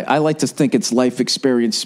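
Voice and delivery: ordinary talk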